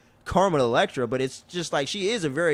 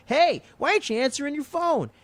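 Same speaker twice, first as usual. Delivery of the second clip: high-pitched mock demand; retold indignant outcry; indignant call; 12 semitones higher than usual. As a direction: burst in calling out, pitched way up. Acting man bursting in, calling out.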